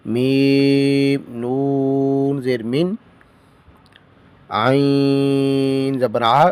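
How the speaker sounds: slow, measured letter-by-letter recitation; slow spelling drill. Shown as a demonstration. Teacher drilling students in spelling, slow recitation. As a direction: chant each letter and vowel slowly.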